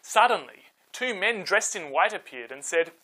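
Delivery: put-on calm storyteller reading to kids; story narration. A narrator's reading. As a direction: tell it gently, like a bedtime Bible story. Reads Bible story to children.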